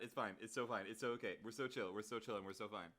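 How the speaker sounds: mock self-soothing. Calming herself after laughing fit.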